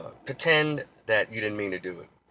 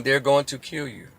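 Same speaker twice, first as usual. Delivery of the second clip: ordinary talk, nothing marked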